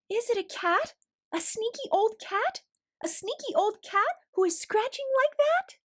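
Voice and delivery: high, nervous, wondering aloud in the night; a small worried creature calling out; timid little Louis voice. Acted. Louis the character speaks.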